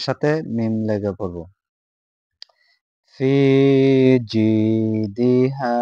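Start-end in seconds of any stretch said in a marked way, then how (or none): 3.1-5.8 slow, drawn-out chanted recitation; slow tajweed recitation in Arabic; melodic Quran recitation. Shown as a demonstration. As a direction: chant each syllable long and sung. Recites verse.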